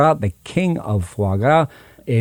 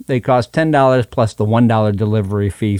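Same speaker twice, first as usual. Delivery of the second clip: normal talking voice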